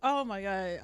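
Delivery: ordinary talk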